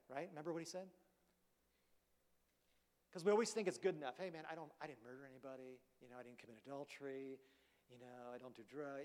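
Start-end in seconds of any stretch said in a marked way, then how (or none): 4.2-9.0 much softer, offhand self-justifying mumble; muttered excuse. Retold or imitated. A complacent believer defending himself, soft offhand mumble. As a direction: shrug it off as if it's good enough. Self-satisfied listing of virtues.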